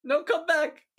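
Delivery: high, loud plea after someone; desperate call. Pretend. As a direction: call them back, pleading. Calling after footsteps walking away.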